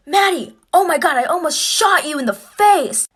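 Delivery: high, loud imitated outburst; mock parental freak-out, slow and loud; shocked dad. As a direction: act a horrified father, stretching the words. Acts out shocked dad's outburst.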